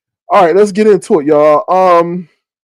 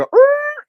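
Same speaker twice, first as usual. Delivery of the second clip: squeaky mock groan much higher; high annoyed groan; 4 dB louder than usual. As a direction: groan high, turning away. Reacting to beggars at the pump.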